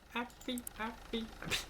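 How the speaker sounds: high squeaky happy chant; high-pitched mock joy; happy squeal. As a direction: squeal it like a giddy kid. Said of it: "I was like".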